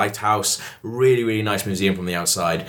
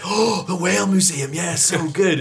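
higher-pitched mock enthusiasm; enthusing local; 7 semitones higher, slower than usual. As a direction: gush like an impressed local. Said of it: "the guy was like".

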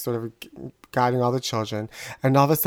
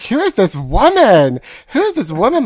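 mock incredulous; 8 semitones higher, 6 dB louder than usual. Daughter reacts to her laughing mother, mock incredulous. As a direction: ask it aghast, building in pitch.

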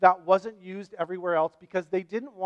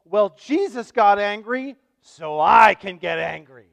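mock angry; 11 dB louder than usual. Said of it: "people will read this and go".

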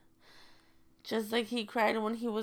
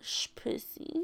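hushed; 8 dB quieter, slower than usual. Real, not acted. Hushed echo of rude word.

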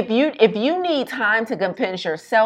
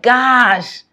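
exasperated friend; 4 semitones higher, 6 dB louder than usual. Retold or imitated.

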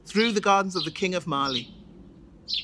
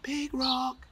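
soft, higher hushed call; whisper; 7 semitones higher, 6 dB quieter than usual. Acted. Little Rock gently waking his sleeping friend, hushed. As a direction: breathe his name gently to wake him. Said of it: "and he whispered".

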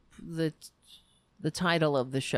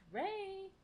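much higher, soft call of the name; high pet call; 14 semitones higher, 15 dB quieter than usual. Real, not acted. Calling her cat Ray, high soft call.

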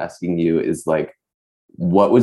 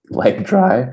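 voice cracking; 8 semitones higher, 3 dB louder than usual. Real, not acted. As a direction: voice cracks high on dry. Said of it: "yes, my voice broke again".

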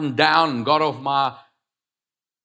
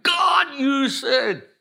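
crying out to God; 13 semitones higher, much slower than usual. Retold or imitated. Preacher reliving a sickbed cry to God, anguished shout. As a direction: wail it up at heaven like a desperate plea. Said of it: "and I'm calling out".

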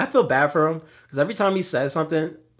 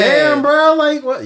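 mock disbelief; 11 semitones higher, 7 dB louder, slower than usual. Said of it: "I'm like".

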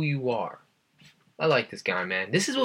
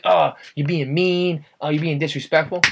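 mock offended crowd; 5 semitones higher, 3 dB louder than usual. Host retelling the TikTok backlash, higher mock-offended voice. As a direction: imitate people clutching their pearls.

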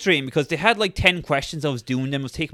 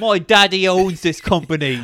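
mock spoiled rich kid; 4 semitones higher, 3 dB louder than usual. Mocking entitled rich candidate.

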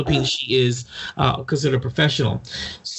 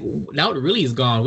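ordinary talk, nothing marked

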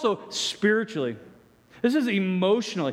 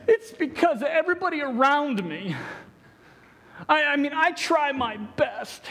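whiny excuse voice; 6 semitones higher than usual. Retold or imitated. Voicing the lame man's excuses.

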